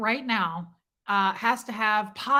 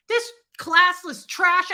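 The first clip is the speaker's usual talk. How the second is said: slow, loud, higher sneer; scornful; 8 semitones higher, 8 dB louder, slower than usual. Retold or imitated. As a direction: spit out the insult. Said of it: "I was like".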